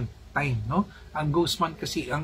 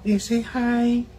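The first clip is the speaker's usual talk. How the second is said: high, slow coaxing sing-song; 9 semitones higher, 5 dB louder, much slower than usual. Real, not acted. Mother urging her toddler to say hi, high slow coax.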